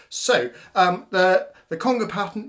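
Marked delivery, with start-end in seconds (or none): none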